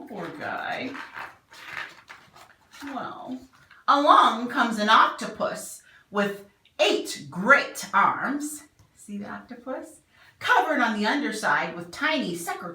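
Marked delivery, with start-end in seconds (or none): none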